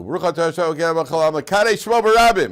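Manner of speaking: fast, rising shout of a blessing; yelled blessing. Retold or imitated. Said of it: "So the guy yells out".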